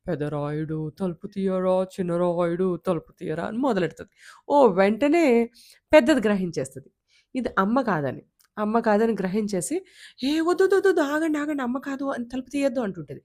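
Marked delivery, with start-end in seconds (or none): 0.1-3.4 "పెద్ద రాయుడు తలుపు తీయరా" chanted in a strange, unmotherly voice; a fox faking the mother's song, not quite right; fox's imitation of the song. Fox at the door chants the mother's song in a wrong, strange voice. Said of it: "దాని గొంతు ఏమో వాళ్ళ అమ్మ గొంతులా ఉండదు". 10.2-12.8 much higher, loud alarmed cry; panicked warning. Acted. Eldest rabbit shrieks at the little ones not to open the door, panicked.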